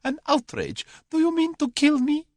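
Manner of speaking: loud, very high hysterical protest. A narrator's reading. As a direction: cry out in panicked indignation. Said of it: "hysterical voice".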